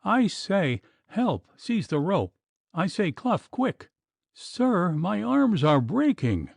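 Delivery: high, strained cries for help; desperate pleas. A narrator's reading. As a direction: beg for help with arms giving out. Puttock straining, calling for help.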